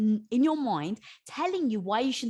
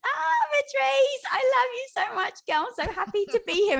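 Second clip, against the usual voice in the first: high, loud gushing greeting; overjoyed to see her friend; excited; 13 semitones higher, 7 dB louder than usual. Real, not acted. Guest gushing hello to the host, excited. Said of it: "in case you can't tell by how excited my tone is".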